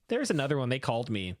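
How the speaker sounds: normal talking voice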